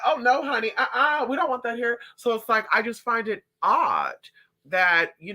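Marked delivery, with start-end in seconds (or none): 0.0-2.0 high, prissy "oh no honey" voice; a hint of mock-proper locals; mock prim townsfolk. Gossip voicing small-town officials, mock prim. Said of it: "They're like".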